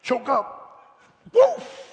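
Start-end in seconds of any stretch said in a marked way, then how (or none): 1.3-1.8 preacher's loud dog bark; excited woof at the crowd. Real, not acted. Preacher barking at congregation.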